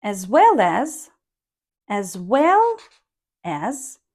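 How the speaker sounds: "well" stressed, rising on "as". Shown as a demonstration. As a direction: lean on "well" and lift "as". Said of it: "so that's why well will be stressed, as can go with rising tone".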